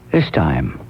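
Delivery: a low, solemn this time; low dramatic repeat. Pretend. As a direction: sink the voice way down on the repeat. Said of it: "say to yourself".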